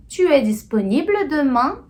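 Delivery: rising question tune, lifting on "demain"; tom de pergunta. Shown as a demonstration. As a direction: ask "Tu es disponible demain?" with the voice climbing at the end. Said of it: "depende aí do tom da sua voz". (entonação de pergunta, voz subindo em "demain")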